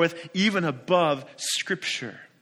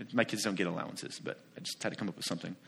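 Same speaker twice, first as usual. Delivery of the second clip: quiet aside; 7 semitones lower, 10 dB quieter, much faster than usual. Real, not acted. Aside muttered under breath.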